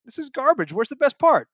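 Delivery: hammed-up disgust, voice up high; mock outraged complaint. Fan watching episode on TV, complaining, mock indignant. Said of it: "watching part one on T V and saying".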